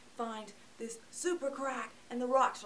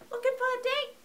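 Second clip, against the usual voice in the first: high-pitched, louder reanimated hooker voice; Frankenhooker imitation; 14 semitones higher, 9 dB louder, faster than usual. Reviewer imitating the reanimated hooker, high put-on voice. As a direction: mimic a creature parroting pickup lines, high and loud. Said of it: "She's like".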